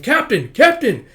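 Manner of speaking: loud, high, drawn-out cries; alarmed calling. Acted. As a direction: cry out slowly for the captain. Soldier cries for captain.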